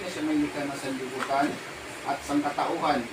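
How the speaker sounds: low, soft incantation; ritual prayer. Real, not acted. Healer invoking God over the sick man, solemn murmur. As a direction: recite it like a solemn rite.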